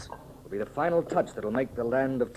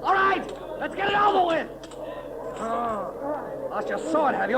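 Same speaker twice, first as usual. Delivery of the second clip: taunting battle shout; 14 semitones higher, slower than usual. Acted. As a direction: goad your foe into charging. Taunts opponent during sword fight.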